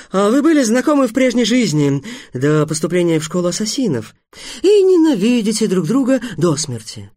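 eager, mostly high-pitched excitement; delighted. A narrator's reading. Teacher relishing pupils' old mutual hatred, delighted and eager. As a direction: savour each discovery with rising relish. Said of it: "восхитился учитель Апф".

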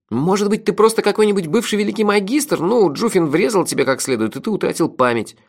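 high-pitched, animated friend's voice; lively sidekick, speculating. Acted. Narrator voices the friend Melifaro. (высокий голос бойкого напарника)

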